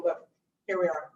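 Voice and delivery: ordinary delivery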